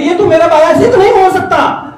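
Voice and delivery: high, loud, firm denial; stern mother. Retold or imitated. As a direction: repeat the mother's refusal with force. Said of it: "وہ کہتی". (اونچی آواز میں ماں کا سخت انکار)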